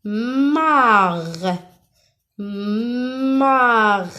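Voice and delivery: "Mar" with a long m and long vowel; stretched syllable. Shown as a demonstration. Teacher confirming the first syllable, "Mar" slowly stretched. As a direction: draw "Mar" right out from a hummed m. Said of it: "dans la première syllabe".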